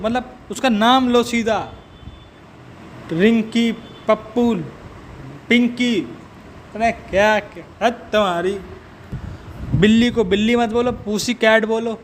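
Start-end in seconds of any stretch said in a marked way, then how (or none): none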